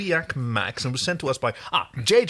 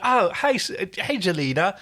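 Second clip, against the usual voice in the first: put-on light, high Australian accent; a hint of broad Australian; 6 semitones higher than usual. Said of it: "It was the super Australian".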